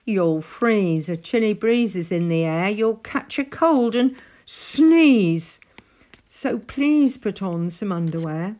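loud, nagging animal warning; emphatic storybook fussing; scolding Weasel. A narrator's reading. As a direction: warn forcefully, stretching the big words. Weasel scolds and warns Spike.

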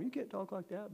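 high, soft imitated reproach; mock scolding. Pastor quoting a rebuke he didn't give, mock scolding.

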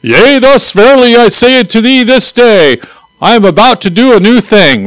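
high, solemn King James prophet; mock biblical prophet. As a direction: intone it like an old-time prophet. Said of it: "Remember the prophetic word".

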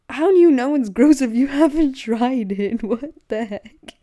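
fed up with people who judge; incredulous half-laughing rant; exasperated outburst. Real, not acted. Ranting about pineapple pizza haters.

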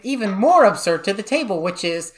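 can barely talk for laughing. Real, not acted. Laughing at brother's absurd claim.

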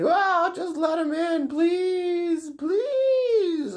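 slow, very high mock wail; pleading skeleton woman. Skeleton mother begs for her boys, high wailing plea. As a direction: beg in a hammed-up screech, rising. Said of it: "she's like".

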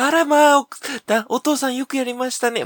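high, loud, prim housewife voice; mother voice. Acted. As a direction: gush like a delighted mother. Mother praising father.

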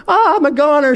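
a ghost flinching from the heat; alarmed ghost. Acted.

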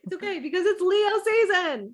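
high laughing reply. Real, not acted. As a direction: laugh it out excitedly.

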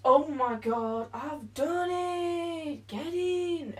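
loud, high cry of triumph; triumphant. Real, not acted. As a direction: stretch the words out in real celebration. Celebrates finishing the job.

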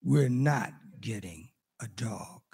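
low, measured, final no; imitating a wife's quiet, deliberate veto; firm refusal. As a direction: lay down the law softly, word by word. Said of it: "she looked at me and she said".

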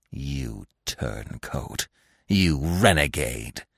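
very slow, low, soft contempt; embittered growl. A narrator's reading. Fox going on accusing the dog, slow bitter growl. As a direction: draw out each insult in a low growl. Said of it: "the fox went on".

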